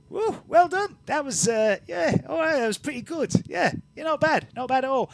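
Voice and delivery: a chirpy little bearded man cheering them on; the bearded arena man praising the fighters, high and cheery; bearded fellow. Acted.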